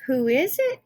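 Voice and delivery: wolf faking a high, loud sweet Granny; wolf posing as Granny in bed, sugary; sweetest Granny voice. Acted. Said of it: "in his sweetest Granny voice".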